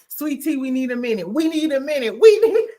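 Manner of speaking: rising, insistent, breaking into laughter; mock urgent. Doctor Jackie shooing Sweet Tea, building urgency. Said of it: "Doctor Jackie was like".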